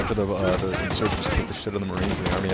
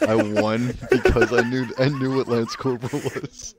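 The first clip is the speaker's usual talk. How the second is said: laughing through the words; 3 semitones higher, 4 dB louder than usual. Real, not acted. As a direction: giggle while telling it. Laughing while telling.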